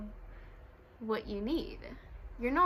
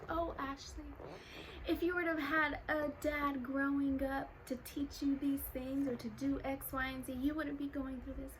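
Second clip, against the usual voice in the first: higher, softer, put-on voice of pitying people; mock pitying voice; 3 semitones higher, 6 dB quieter than usual. Mocking people who pity her for growing up without a dad, sugary voice. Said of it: "why they sound like this".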